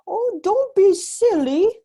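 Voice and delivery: high, loud, slow dismissive wife; giant's wife scoffing. Acted. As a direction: brush off her husband with a scoff. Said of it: "the giant's wife said".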